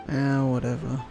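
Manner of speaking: ordinary talk, nothing marked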